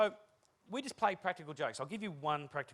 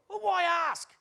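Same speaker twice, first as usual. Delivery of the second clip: exasperated shout; 11 dB louder, much slower than usual. Real, not acted.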